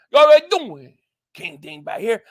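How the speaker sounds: rushed, slurred greeting, high and loud, then dropping; really fast intro. Shown as a demonstration. Streamer demonstrating the video intro, really fast. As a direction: blurt the catchphrase in a high burst. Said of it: "But it's really, really fast".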